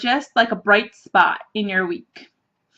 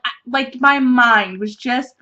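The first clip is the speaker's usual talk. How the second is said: ordinary talk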